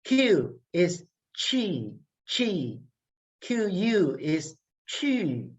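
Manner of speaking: "chi" drawn out slowly with tight lips; slow, intense "chi" with rounded lips; strong umlaut "chi"; Mandarin qi. Shown as a demonstration.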